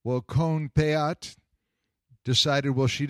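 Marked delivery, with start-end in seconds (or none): none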